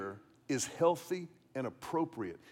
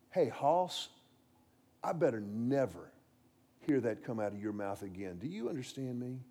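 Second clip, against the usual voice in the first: low, quiet fatherly warning, getting softer; stern without yelling; 6 semitones lower, 7 dB quieter than usual. Retold or imitated. Dad down on his son's level, quiet and stern. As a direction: drop your voice and lay down the law. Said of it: "I didn't yell in this instance".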